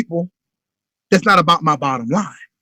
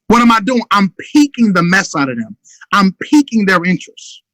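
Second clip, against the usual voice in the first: ordinary talk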